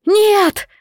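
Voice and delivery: high piercing cry of terror; desperate scream. A narrator's reading. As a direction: scream it in despair. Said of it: "истошный вопль".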